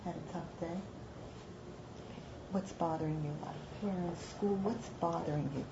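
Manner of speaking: very soft, low, gentle questions; calm them down quietly, with pauses between questions; disarming compassion. Acted. Talking down a principal who yelled, hushed and caring. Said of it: "I sit him down and say".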